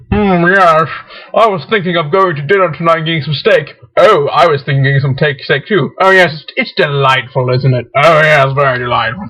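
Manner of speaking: stuffy British small talk, pitched low; mock British accent. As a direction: put on a hammed-up British accent. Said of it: "Yes, a British accent".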